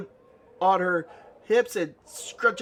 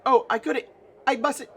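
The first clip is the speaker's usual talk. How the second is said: higher, louder fluttering protest; shocked refusal; 9 semitones higher, 5 dB louder than usual. Acted. Nanny is told to hit the player, high and shocked. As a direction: refuse as if scandalized. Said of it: "looks shocked".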